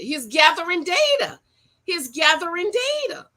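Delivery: high, bright echo; delighted. Real, not acted. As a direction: cheer him on.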